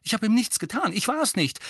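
high, loud denial; accused boy defending himself; indignant protest. Acted. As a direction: protest your innocence urgently. Boy protests his innocence.

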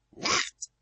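loud, whispery "Nacht"; voiceless, forceful parting word; curt return farewell. A narrator's reading. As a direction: throw the goodnight back forcefully without full voice. Other witch's parting shot back.

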